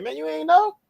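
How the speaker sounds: mock the elders' disbelief; incredulous slang tone. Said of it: "thinking that tone".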